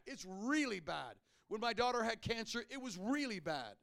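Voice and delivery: loud, high, fervent preaching; full-force preaching. Real, not acted. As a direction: preach it out with fire.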